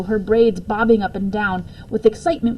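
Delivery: ordinary delivery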